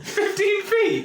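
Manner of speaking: very high, drawn-out disbelief; incredulous outburst. Real, not acted. Player learning their arm has swollen hugely, high incredulous squeal. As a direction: stretch it out in high-pitched astonishment. Reacts to arm swelling enormously.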